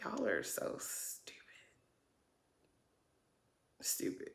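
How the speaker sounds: breathless, unvoiced whisper of contempt; hushed, disgusted whisper; whispered scorn. Real, not acted. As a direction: hiss it under your breath in disbelief. Exasperated aside at the show.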